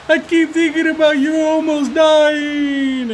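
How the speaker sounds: put-on high, slow tearful child voice; upset daughter. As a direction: plead like a scared little girl. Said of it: "she's like".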